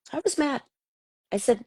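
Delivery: usual speaking voice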